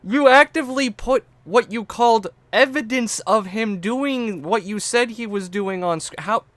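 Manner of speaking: loud, very high outrage; incredulous protest. Real, not acted. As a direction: argue in utter disbelief. Disputes the slip of tongue excuse.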